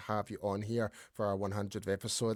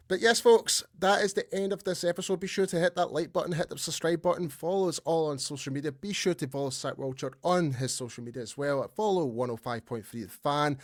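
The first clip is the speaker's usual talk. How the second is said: upbeat host sign-off, higher at the start; podcast outro; 5 semitones higher, 3 dB louder than usual. Acted. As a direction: wrap up the show for the viewers.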